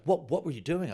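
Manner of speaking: loud, high, accusing; mock angry client questioning; furious client. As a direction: demand answers. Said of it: "she was furious".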